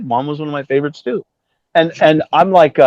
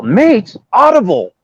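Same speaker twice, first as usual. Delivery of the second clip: higher, louder incredulous queries; retold puzzlement; 7 semitones higher, 5 dB louder, slower than usual. Piercy on phone about the wrong studio, incredulous. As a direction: imitate a caller correcting a mix-up. Caller corrects the rehearsal place.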